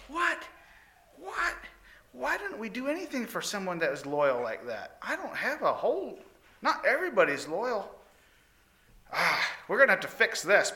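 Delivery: high, startled king voice; the king can't believe it; shocked king. Acted. King reacts to the servants' answer, high and shocked.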